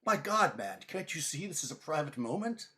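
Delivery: acted outburst, high and loud at the start; tearful indignation. Knight scolding an intruder on his reunion, hammed-up tearful indignation. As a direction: burst out, offended at the intrusion. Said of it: "with tears coming out".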